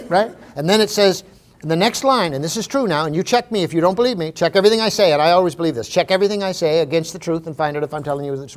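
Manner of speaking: normal talking voice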